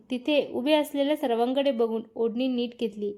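plain reading voice

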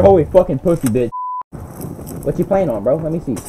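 ordinary talk

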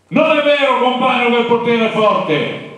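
high, loud rally voice; the leader contradicting the crowd; Capanna orating. Retold or imitated. Capanna replies to students. (Capanna che arringa, voce alta e forte)

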